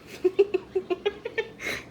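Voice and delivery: high-pitched laughing fit. Real, not acted. Laughing at two pieces of wood.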